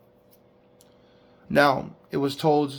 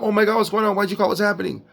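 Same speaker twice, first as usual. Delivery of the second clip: higher-pitched imitation of panic; mock freak-out; 6 semitones higher, faster than usual. Preacher mimics what calm dispatchers don't do, mock freak-out. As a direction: act out a flustered, freaked-out call taker. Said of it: "They're not freaking out".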